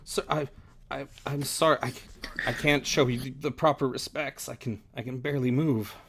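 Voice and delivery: panicky stammering. Acted. Exhausted survivor apologizes to his superior for not showing respect, stammering. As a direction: trip over the first words of each apology. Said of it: "he just starts kinda stammering".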